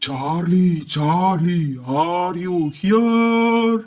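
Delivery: drawn-out eerie call to a spirit; spooky summoning voice. Acted. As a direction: stretch every word like a séance. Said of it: "ஏன் பயங்கரமா குரலை மாத்தி பேசுறீங்க".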